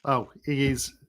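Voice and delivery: ordinary delivery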